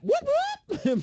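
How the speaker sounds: high-pitched imitation police siren; playful high siren noise; mock siren whoop. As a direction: whoop like a police car pulling someone over. Acts out police siren on emu.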